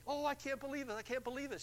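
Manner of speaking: high, loud squeal of joy; retold caller's high excited squeal; all happy. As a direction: gush like a thrilled caller. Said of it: "she's all happy".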